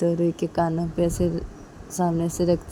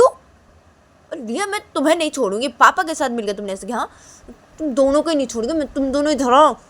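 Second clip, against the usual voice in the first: much higher, fast, louder threat; threatening both; 8 semitones higher, 6 dB louder than usual. Acted. Mother threatens husband and daughter, angry. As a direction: come here, both of you.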